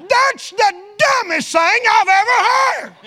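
loud, high, slow laughing exclaim; laughing outburst. Real, not acted. Preacher mocking the lady's grudge, laughing loud and high. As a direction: laugh it out in disbelief.

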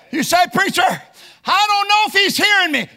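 loud, high preacher's shout; fiery preaching. Acted. Preacher crying out to the congregation, loud and high. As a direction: preach it full force from the pulpit.

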